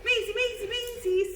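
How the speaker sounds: high-pitched sing-song name calls; lilting high dog call. Real, not acted. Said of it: "High voice".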